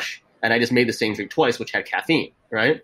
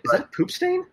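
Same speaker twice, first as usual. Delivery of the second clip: disgusted disbelief. Retold or imitated. Mock-incredulous quote to a customer.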